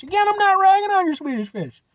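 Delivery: a high, whiny, defensive denial; exasperated protest. Real, not acted. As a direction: pitch it up in mock outrage, defending yourself. Defending himself against imagined commenters.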